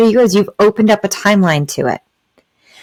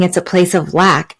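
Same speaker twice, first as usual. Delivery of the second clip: ordinary talk, nothing marked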